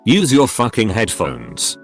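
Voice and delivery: usual speaking voice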